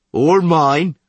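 loud, high crude taunt; loud bawdy guard. Acted. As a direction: boast it crudely.

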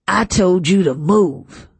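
slow, forceful angry order; snarl. A narrator's reading. Master ordering the girl up, snarling. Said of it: "Mister Roberts snarled at me".

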